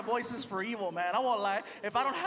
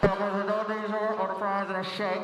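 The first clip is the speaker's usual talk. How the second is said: drive-thru clerk; 2 semitones lower, 3 dB louder than usual. Acted. Drive-thru clerk repeats order.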